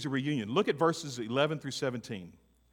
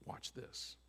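hushed aside; 18 dB quieter than usual. Real, not acted. Preacher sets up dramatic moment.

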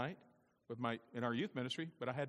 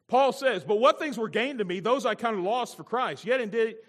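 loud, high preacher's proclamation; raised voice, emphatic scripture delivery; forceful scripture reading; 8 semitones higher, 11 dB louder than usual. Real, not acted. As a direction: declaim the verse with full pulpit force.

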